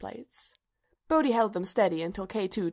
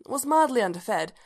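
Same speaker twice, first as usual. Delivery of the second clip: ordinary delivery, nothing marked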